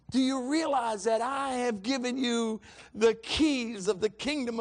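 high, impassioned pulpit exhortation; full-force preaching. Real, not acted. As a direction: urge the congregation with rising fervour.

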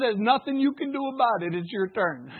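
ordinary talk

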